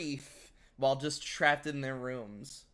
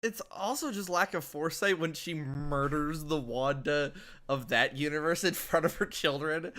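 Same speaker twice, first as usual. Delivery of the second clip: ordinary talk, nothing marked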